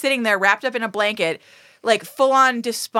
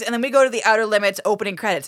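ordinary talk, nothing marked

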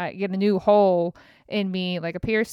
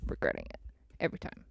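whispered regret, fast; 12 dB quieter, much faster than usual. Real, not acted. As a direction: breathe it out under the breath.

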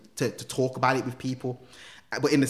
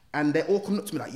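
usual speaking voice

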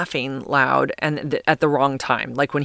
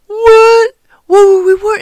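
shrill, much higher mock-innocent squeal of disbelief; acting shocked; 12 dB louder, slower than usual. Drunk concertgoer feigning shock at security's warning, loud high squeal. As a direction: play the drunk guy faking shock at the complaint, loud and high. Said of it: "acting, you know, so, like, shocked that somebody complained".

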